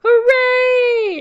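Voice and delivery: high, loud, drawn-out cheer; crew's shout. A narrator's reading. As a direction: cheer like a whole happy crew. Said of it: "shouts the crew".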